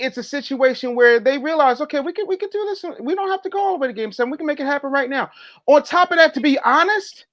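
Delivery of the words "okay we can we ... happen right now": fast quoted thought. Retold or imitated. Team realizing they can win.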